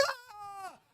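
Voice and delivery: high, held-back little scream; thin, soft, high cry of fright; startled shriek. Retold or imitated. Boy startled by a flame in his face, soft shriek.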